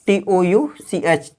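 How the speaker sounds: letter by letter, slowly; spelling aloud. Shown as a demonstration. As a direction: spell it out for the students. Teacher spells the word. (अक्षर-अक्षर बोलना)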